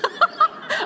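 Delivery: a sudden, high-pitched laugh; high burst of laughter. Real, not acted. Laughs at lights turned down.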